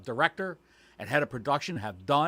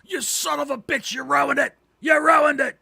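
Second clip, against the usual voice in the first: high mock rant building to a full shout; Vince McMahon screaming; 12 semitones higher, 7 dB louder than usual. Boss berating Renee for ruining it, screaming mock fury. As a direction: escalate into an enraged boss's bellow. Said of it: "That's what he said to Renee".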